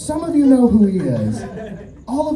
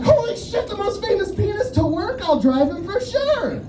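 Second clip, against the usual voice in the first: very high-pitched gushing squeal; hammed-up eagerness, jumping at the chance; starstruck excitement; 14 semitones higher than usual. Acting his eager yes to manager.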